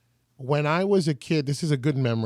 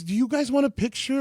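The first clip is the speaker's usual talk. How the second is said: kind offer; 8 semitones higher than usual. Retold or imitated. Selena offering a photo.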